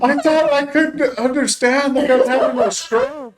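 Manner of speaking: high-pitched squeal through laughter; hammed-up, laughing high whine; mock panicked relief. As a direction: imitate a freaked-out friend, voice way up high. Imitates friend's panicked relief, laughing.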